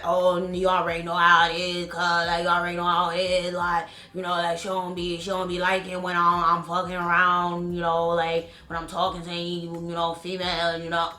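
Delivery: low, monotone male impression; imitating his talk. Mimicking JJ making excuses to her, low droning impression. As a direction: drone it low and soft, one note throughout. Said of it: "that's how he talk".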